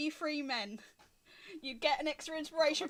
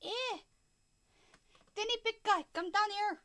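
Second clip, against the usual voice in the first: loud, raised-pitch call from a little man; tiny kilted man; 8 semitones higher, 5 dB louder than usual. Acted. Tiny piper calls the big guy down, little man's voice. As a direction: call up loudly to someone far bigger. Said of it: "walks out and goes".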